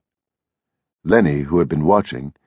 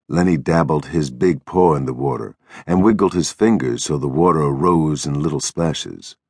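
ordinary delivery

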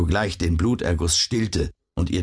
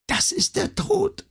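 very high, slow dying voice; failing voice, high and drawn out; faint stammering. A narrator's reading. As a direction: stammer it thinly with the last strength. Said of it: "vermochte sie nur noch zu stammeln".

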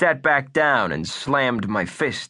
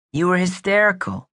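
put-on hard-boiled kid sleuth, higher voice; wisecracking boy detective voice; tough kid detective; 8 semitones higher than usual. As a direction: play it like a pint-sized noir detective.

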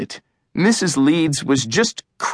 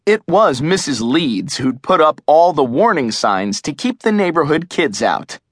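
plain reading voice

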